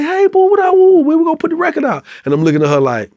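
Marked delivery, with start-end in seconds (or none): 0.0-2.0 put-on much higher, chirpy girl voice greeting. Singer greeting him backstage, mock high female voice. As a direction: play her up sweet and high, singer's bright hello. Imitating [name] greeting.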